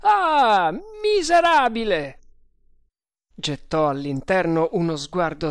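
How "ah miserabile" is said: loud, high burst of rage; furious howl. A narrator's reading. Guard leaps to the loophole, howling in rage. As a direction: let the yell burst from trembling lips. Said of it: "Un secondo urlo gli irruppe".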